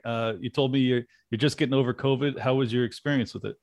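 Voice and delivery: ordinary delivery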